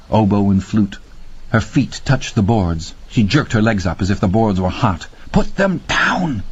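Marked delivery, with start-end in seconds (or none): 5.3-6.4 hissed. A narrator's reading. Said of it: "he hissed at her".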